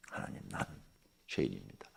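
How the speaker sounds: hushed, near-whispered confession to God; confessing prayer. Retold or imitated. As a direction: breathe it out like a sinner's plea. Confessing to God, beating chest.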